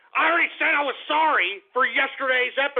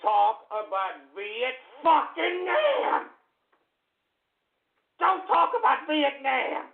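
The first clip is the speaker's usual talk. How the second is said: slow, climbing angry yell; drawn-out, outraged order; furious warning; slower than usual. Real, not acted. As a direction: stretch each word out in mounting rage. Furious at Vietnam being mentioned.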